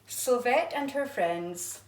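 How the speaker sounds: ordinary delivery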